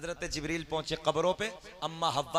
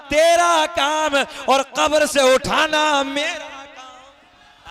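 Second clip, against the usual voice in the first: loud, high proclamation; fervent sermon proclamation; full-force preaching; 9 semitones higher, 12 dB louder, slower than usual. Acted. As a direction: thunder it out to the gathering. Preacher declaims divine speech.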